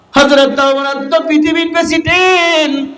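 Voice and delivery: high, ringing sermon cry; fervent proclamation. Real, not acted. Preacher hailing Umar as world leader, fervent high proclamation. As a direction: proclaim it on a high held pitch.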